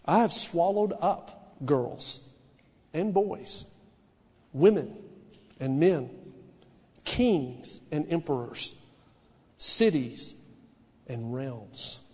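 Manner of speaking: low, slow, quiet lion voice without boasting; matter-of-fact lion. Acted. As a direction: state it calmly, as a lion would. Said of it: "He just said it".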